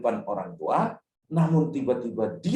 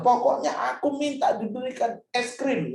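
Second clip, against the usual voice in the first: put-on much higher childish demand; 9 semitones higher than usual. Child insisting on ice cream, mock whiny demand. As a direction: whine for ice cream like a kid. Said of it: "Kalau misalnya".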